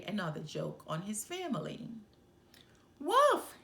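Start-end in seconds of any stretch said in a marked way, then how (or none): 3.0-3.6 very high, very loud cry of wolf; a boy's piercing alarm call; cried out. A narrator's reading. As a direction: scream the false alarm. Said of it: "he cried".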